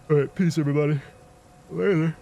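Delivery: sign-off through a yawn. Real, not acted. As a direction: yawn the words out tiredly. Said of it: "I'm yawning a lot".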